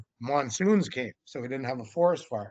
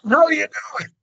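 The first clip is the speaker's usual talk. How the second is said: much higher, loud mock greeting yelled; sudden shout; 13 semitones higher, 8 dB louder, faster than usual. Man leaping from the leaves at Sasquatch, shouting a greeting. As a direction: burst out and holler it.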